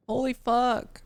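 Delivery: ordinary delivery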